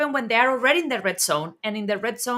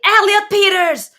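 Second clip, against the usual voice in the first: put-on sharp shout of the child's full name; yelling his name; 10 semitones higher, 10 dB louder than usual. Exhausted mom yelling at her newborn, a loud high shout. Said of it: "yell at him and say".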